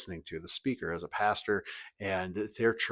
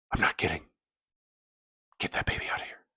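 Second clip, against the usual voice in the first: low-volume, whispered insistence; acted stern hush; 4 semitones lower, faster than usual. Woman in church insisting to the parents, stern whisper. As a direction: imitate a hushed, deadly serious demand.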